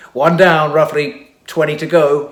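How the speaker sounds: loud, high triumphant call; exclaimed. A narrator's reading. Ogmont after kicking a criminal off, exclaiming loudly. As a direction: crow it out mid-fight. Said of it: "he exclaimed".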